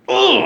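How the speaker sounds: loud, high outraged groan; angry groan. Retold or imitated. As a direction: let out a furious disgusted grunt. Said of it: "I was fucking angry".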